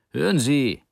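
put-on doctor sternly interrupting the scolding boyfriend. A narrator's reading. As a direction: cut him off like a strict doctor. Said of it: "mit strenger Stimme".

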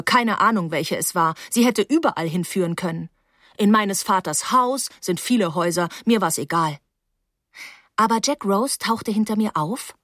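ordinary delivery, nothing marked

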